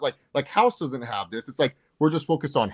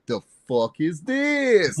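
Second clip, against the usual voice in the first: slow, much higher, drawn-out "The fuck is this?"; Romeo's version; 5 semitones higher, much slower than usual. Retold or imitated. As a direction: mimic a friend dragging out the catchphrase. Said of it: "Romeo sometimes ... when he's like".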